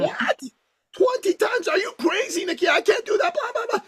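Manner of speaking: high, panicky squeal of a scared client; panicked client. Retold or imitated. Client panicking at twenty times the price, high squeal. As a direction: squeal it out like he's freaking out. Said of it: "He started to hyperventilate".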